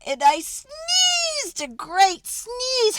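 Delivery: high, slow and loud, winding up a sneeze; building to a sneeze. A narrator's reading. Sneeze built up in story.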